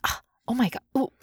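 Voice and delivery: soft, nose-wrinkled revulsion; quiet, hammed-up queasy groans; feigned disgust. Shown as a demonstration. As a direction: recoil as if faking nausea. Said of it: "you wrinkle your nose up".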